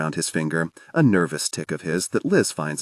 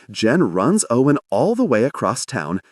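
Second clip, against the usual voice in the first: plain reading voice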